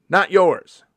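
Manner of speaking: high, loud repeated accusation; loud, high mock-indignant accusation; mock accusing. Real, not acted. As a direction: call them out, indignant. Accusation continues.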